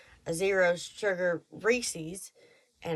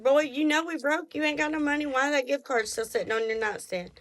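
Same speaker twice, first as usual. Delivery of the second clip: higher, sassy wife scolding; 5 semitones higher than usual. Retold or imitated. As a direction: scold him with playful Southern sass. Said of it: "I'm like".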